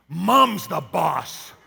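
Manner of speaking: loud, very drawn-out retold chorus; mock kids' shout. Kids pointing out Mom to the guide, loud drawn-out shout. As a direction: call it out slowly, stretching each word. Kids answer together, acted out.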